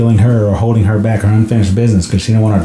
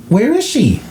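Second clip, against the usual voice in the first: alarmed question; 12 semitones higher than usual. Retold or imitated. Mom's alarmed question in retold scene.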